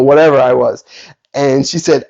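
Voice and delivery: normal talking voice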